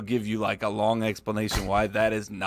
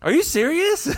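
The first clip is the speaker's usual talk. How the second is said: much higher, incredulous question; astonished brother; 3 dB louder than usual. Retold or imitated. Younger brother asks about the Sanger Theater, stunned disbelief. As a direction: play the stunned little brother. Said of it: "he was like".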